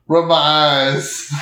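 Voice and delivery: ordinary delivery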